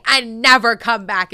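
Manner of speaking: loud, slowed retold shout; angry owner. Owner banning her best friend from the shop, angry shout. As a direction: imitate an owner throwing someone out, loudly. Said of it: "the owner was like".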